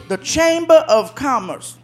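ordinary talk

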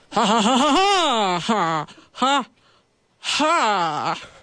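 high, hammed-up evil cackle; stretched-out "ha ha" trying to sound scary; mock villain laugh. Bandit trying to look very scary, hammed-up villain laugh. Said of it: "tried to look very scary".

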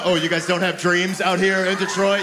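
normal talking voice

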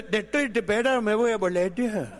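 lisping through the words; despairing speech defect voice. Retold or imitated. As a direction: mangle the consonants like Miguelito. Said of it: "Hablaba arte".

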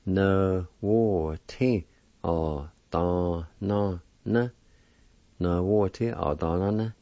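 syllables "no" "wo" "ti" "o" "do" "no" "na" run together in one word; joined together. Shown as a demonstration. Teacher joining the syllables into one word. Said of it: "And together".

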